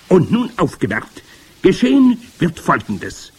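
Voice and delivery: high, loud witch lecturing her followers; forceful high witch voice; grand witch. A narrator's reading. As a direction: spell out the plan with commanding relish. Grand witch explaining her potion.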